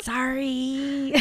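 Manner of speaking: soft, drawn-out sorry; mock sheepish apology. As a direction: apologize meekly as the pup who slipped up. Seth apologizes after mistaken howl, acted.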